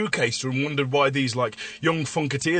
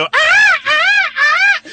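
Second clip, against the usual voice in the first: shrill comic whoop, much higher and louder; high yelping cry; 7 dB louder, much slower than usual. Acted. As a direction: yelp it out like a madman.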